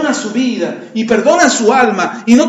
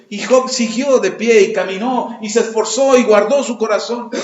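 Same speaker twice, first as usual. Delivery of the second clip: ordinary delivery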